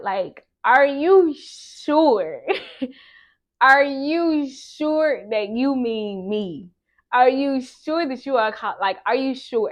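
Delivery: high, loud, drawn-out disbelief; incredulous questioning. Retold or imitated. Student asking God whether the calling is meant for them, incredulous. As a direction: stretch it out high and loud, doubting.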